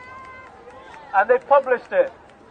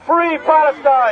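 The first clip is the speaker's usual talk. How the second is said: higher, slow rallying cry; loud, clear shout; 4 semitones higher, 4 dB louder, slower than usual. Real, not acted. Rally speaker wanting the world to hear, loud clear shout. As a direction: send it out slowly to the whole crowd. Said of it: "Let them hear it loud and clear".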